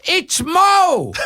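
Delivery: put-on high-pitched kid's yell from memory; excited yelling. Grown man re-enacting his childhood shout to his mother, yelling. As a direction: yell it out like a thrilled kid. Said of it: "I started yelling out".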